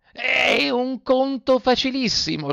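high, slow, whiny fox voice; sly fox. A narrator's reading. Fox coaxes Pinocchio, high slow whine. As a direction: wheedle it in a high put-on whine. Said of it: "rispose la volpe".

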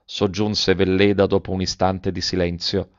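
usual speaking voice

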